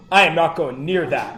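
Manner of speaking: usual speaking voice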